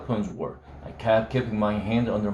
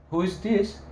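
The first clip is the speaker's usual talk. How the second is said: a much higher, frosty grandmother's voice; higher, icy grandmother; cold old lady; 9 semitones higher than usual. Acted. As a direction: answer like a chilly grandmother woken at night. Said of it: "this very cold lady's voice said".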